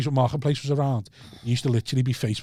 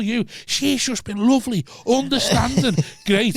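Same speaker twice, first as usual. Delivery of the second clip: mock rant to mate; 10 semitones higher than usual. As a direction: bellow it like you can't believe it. Ranting to mate on phone.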